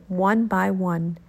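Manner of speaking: usual speaking voice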